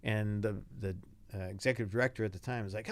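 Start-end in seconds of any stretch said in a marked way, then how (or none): none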